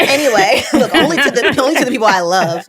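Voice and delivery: amused, words broken by real laughter; laughing. Real, not acted. Laughing while defending herself.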